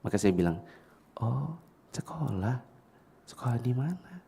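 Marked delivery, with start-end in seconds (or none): 1.2-4.2 very soft, slow, gentle question; lowered voice. Retold or imitated. Adult modelling quiet speech to small boy, very soft. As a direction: hush right down for the child. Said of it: "pelankan suaranya, eh, suara saya".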